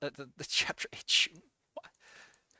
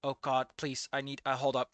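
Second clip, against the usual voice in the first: ordinary delivery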